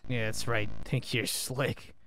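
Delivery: soft, low muttered gloat; low taunting. Real, not acted. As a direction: mutter the taunt under the breath. Muttered taunt after demon retreats.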